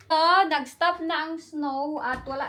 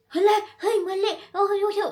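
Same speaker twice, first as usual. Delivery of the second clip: mock scolding; 7 semitones higher than usual. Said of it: "Mali lang ng konti".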